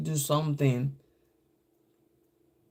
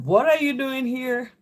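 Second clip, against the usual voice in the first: lightly voiced surprised mom, pitched up; imitated mother; 8 semitones higher than usual. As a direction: imitate a mother demanding an explanation, higher pitch. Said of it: "My mom would be like".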